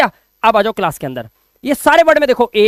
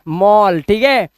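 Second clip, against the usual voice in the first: ordinary talk, nothing marked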